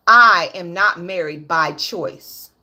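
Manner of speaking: "I am not married by choice" slow and measured, each word set apart; deliberate emphasis. Real, not acted. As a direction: spell it out slowly, word by word. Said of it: "Let me make this very clear".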